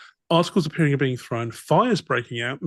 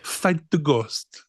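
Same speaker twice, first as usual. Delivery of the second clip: put-on Clouseau-esque French accent; much slower than usual. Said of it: "Clouseau-esque".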